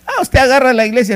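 loud, high mock accusation; accusing churchgoer. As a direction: play a suspicious critic calling out the pastor. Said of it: "Alguien puede decir".

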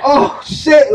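loud, high exclamation; retold shock. Reliving his first time ending early, high loud shock. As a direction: blurt it out in alarm.

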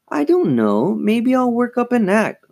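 high, drawn musing; thoughtful. A narrator's reading. Lindsay considers her plans, thoughtful. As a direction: muse aloud with lifted pitch. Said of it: "Lindsay said thoughtfully".

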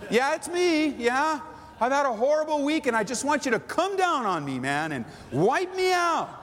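hammed-up begging to be judged; mock prayer. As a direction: cry it out as an absurdly eager prayer. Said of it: "prayed like this".

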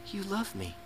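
quiet, hushed and touched; soft realization. Retold or imitated. Teenager realizing the parent loves them. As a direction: breathe it out softly.

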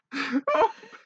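high, loud fit of laughter; helpless laughter. Real, not acted. Reader losing it over the kidnapping line, helpless laughter. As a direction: crack up completely.

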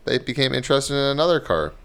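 reading a news report aloud; steady newscaster read. Acted. Reads a news story aloud.

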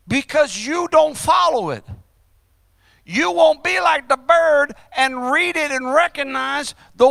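high-pitched, emphatic sermon delivery; full-force preaching. Real, not acted. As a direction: preach it hard to the congregation.

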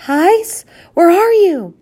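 very high, loud searching call; distant cry. A narrator's reading. Lost husband searches for his wife, calling out. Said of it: "came the muffled cry".

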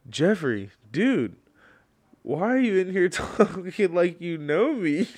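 loud, high imitation of an affronted stranger; mock incredulous. Stranger in chat confronts a false friend, mock incredulous. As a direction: call him out in disbelief, breaking into a laugh.

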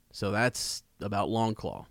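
usual speaking voice

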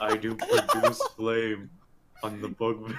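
deep distorted monster roar; low, gravelly-free monster growl; Cookie Monster voice. Real, not acted. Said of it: "like a weird Cookie Monster impression".

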